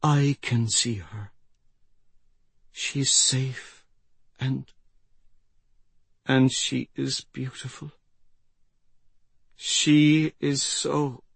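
thin, halting, fading wonder; dying old woman. Acted. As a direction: breathe out last words slowly, with pauses. Dying woman's last words.

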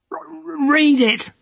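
shrill desperate dare; high urgent cry. A narrator's reading. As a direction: fling the challenge high. Desperate challenge.

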